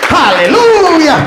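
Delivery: a long, drawn-out, high, loud haleluya; rousing hallelujah. Real, not acted. Pastor rallying the congregation for a shout of praise, soaring. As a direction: lift the church with a slow soaring haleluya.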